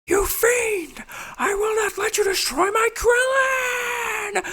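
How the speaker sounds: screaming high and very loud, slow and straining; heroic Goku shout. Retold or imitated. Goku yelling at the villain to save Krillin, huge shout. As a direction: bellow it like an anime hero powering up. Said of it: "he's like".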